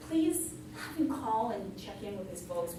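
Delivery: ordinary talk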